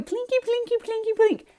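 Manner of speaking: high singsong imitation of plinking keys; clumsy piano plinking. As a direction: mimic a beginner poking at piano keys. Mimics clumsy beginner piano playing.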